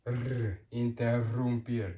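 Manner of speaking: "R" trilled alone, then "Interrumpir" with the same roll; long rolled R. Shown as a demonstration. Teacher drilling two R's, "Interrumpir" with long rolled rr. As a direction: hold a strong trill on the rr of "Interrumpir". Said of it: "you roll and it's longer".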